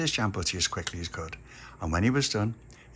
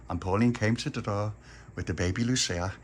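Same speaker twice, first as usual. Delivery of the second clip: ordinary talk, nothing marked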